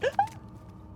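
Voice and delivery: sharp, very high gasp; sudden high intake of breath; startled gasp. Real, not acted. Startled as the switch is pulled.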